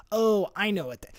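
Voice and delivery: normal talking voice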